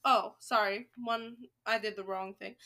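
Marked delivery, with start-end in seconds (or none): none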